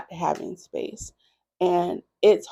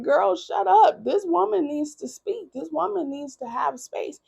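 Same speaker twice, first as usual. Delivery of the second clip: much higher mock scold, slowed on shut up; high, playful self-reprimand; scolding herself; 10 semitones higher than usual. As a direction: tell yourself off, pitched way up. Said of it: "And I was just like".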